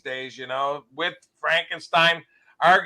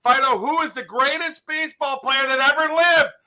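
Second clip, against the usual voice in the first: high-pitched, coaxing question to a dog; eager high question; dog owner quizzing; 8 semitones higher, 4 dB louder than usual. Acted. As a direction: put the quiz to the dog with a showman's lift. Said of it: "The guy goes".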